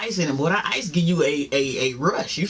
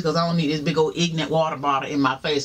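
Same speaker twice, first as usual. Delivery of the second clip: normal talking voice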